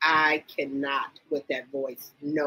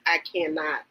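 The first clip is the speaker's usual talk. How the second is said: normal talking voice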